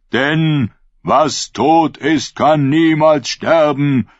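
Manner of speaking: preached creed. Acted. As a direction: declaim the holy words to the crowd. (Prophet ruft das Glaubenswort laut und langsam)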